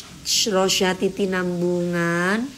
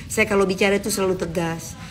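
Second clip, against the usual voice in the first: normal talking voice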